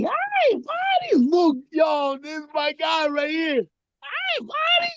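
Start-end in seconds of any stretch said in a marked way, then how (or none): 0.0-1.2 very high-pitched doggy greeting; squeaky hello to a pet; pet-talk voice. Real, not acted. Greeting the dog. 3.6-4.9 very high-pitched doggy greeting; squeaky hello to a pet; pet-talk voice. Real, not acted. Greeting the dog.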